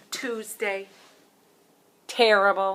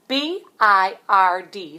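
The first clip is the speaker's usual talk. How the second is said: slow, letter by letter; spelling out; 10 dB louder, slower than usual. Shown as a demonstration. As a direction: spell each letter out slowly.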